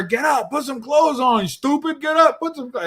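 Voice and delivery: put-on high, yelling New York accent; mock a New Yorker yelling orders; high-pitched mock New York tirade; heavy New York accent. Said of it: "all in heavy New York accents".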